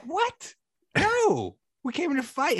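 high, incredulous mock voice; mock tough guy. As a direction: play a puzzled gang member. Mocking gang's confusion, acted.

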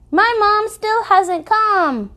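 put-on high, slow little-girl plea; child's plea. A narrator's reading. Little girl begging the narrator to find her mom, high pleading. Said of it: "eyes imploring".

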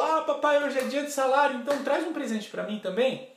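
put-on high, loud child's plea; acted child begging daddy, pitched high; excited little daughter. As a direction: pipe up eagerly like a younger sister. Younger daughter chimes in excitedly.

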